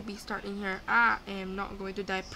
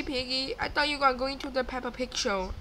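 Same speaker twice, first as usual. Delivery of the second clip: usual speaking voice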